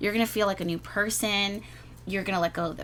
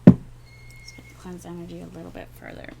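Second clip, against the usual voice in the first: a breathy whisper to yourself; whispered aside; 5 dB louder than usual. Real, not acted. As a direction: murmur it under your breath while cleansing. Aside while cleansing the energy.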